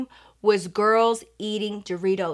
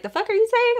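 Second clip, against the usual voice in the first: mock disbelief; 11 semitones higher, faster than usual. Girls react to Dorito diet.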